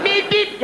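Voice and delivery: two high horn toots; imitated car horn. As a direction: beep like an impatient motorist's horn. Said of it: "bang their horns all the time".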